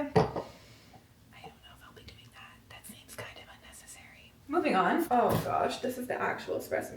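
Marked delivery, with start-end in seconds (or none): 0.0-4.3 whispered aside to the camera; whispering. Real, not acted. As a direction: drop to a breathy, secretive whisper.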